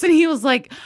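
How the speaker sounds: ordinary talk, nothing marked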